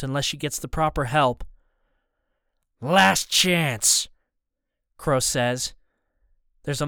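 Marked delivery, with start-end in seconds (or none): 2.8-4.1 loud villain's threat; loud, menacing ultimatum; final warning. A narrator's reading. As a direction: call out the warning with force.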